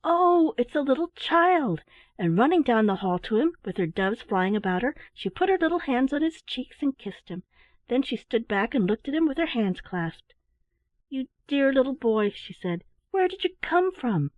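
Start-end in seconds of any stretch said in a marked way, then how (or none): 0.0-1.8 excited cry. Acted. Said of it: "she cried out". 11.1-14.3 put-on little girl voice. Starline greets Teddy warmly.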